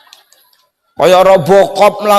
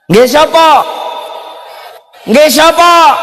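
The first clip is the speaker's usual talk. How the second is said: voiced villager question; 8 semitones higher than usual.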